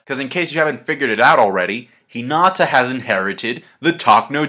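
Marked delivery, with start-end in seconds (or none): none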